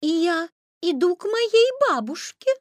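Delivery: put-on high, loud little-girl voice; high-pitched, courteous child; polite little girl. A narrator's reading. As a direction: pipe up politely as a small girl. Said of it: "ответила красная шапочка вежливо".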